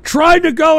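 angry build-up; heated rant. Real, not acted. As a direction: lean into every word. Rant at caller.

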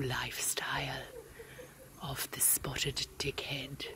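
hushed whispering wildlife narrator; nature documentary. Acted. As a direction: narrate like David Attenborough, low and hushed. Whispered wildlife commentary parody.

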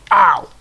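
sudden loud "ow"; sharp game-reaction cry; loud pained yelp. Real, not acted. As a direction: yelp out as if hit. Hit during the game.

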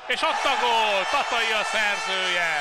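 loud, high goal shout; excited goal call. Real, not acted. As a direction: yell the goal out, thrilled. Goal scored in live commentary.

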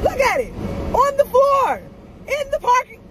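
very high, loud squealed outburst; astonished shriek. Real, not acted. Driver spotting the lost glove outside, astonished shriek. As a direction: shriek each short phrase in disbelief.

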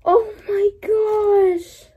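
high, long drawn-out oh my gosh; awestruck gasp. Real, not acted. Fan unboxing a Winnie the Pooh wallet, awestruck. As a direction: squeal it slowly, overcome with delight.

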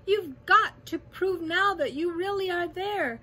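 high, urgent rallying call. A narrator's reading. Elephant urging the mayor to prove they exist, urgent calling. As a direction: call out insistently, pressing every point. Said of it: "Horton called".

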